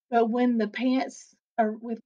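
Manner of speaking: ordinary delivery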